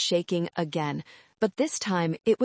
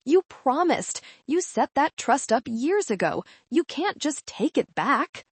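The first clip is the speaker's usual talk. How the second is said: mild mock scream; 7 semitones higher than usual. A narrator's reading. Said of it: "She was screaming now".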